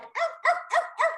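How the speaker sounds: very high yapping arfs; yappy dog barks. Retold or imitated. Imitating the dogs jumping around, yappy barks. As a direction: yap like a small excited terrier. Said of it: "the Sky dogs are like".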